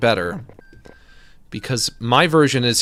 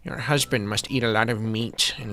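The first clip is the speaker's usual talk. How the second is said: plumber Luigi. Acted. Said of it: "plumber Luigi talking to customer".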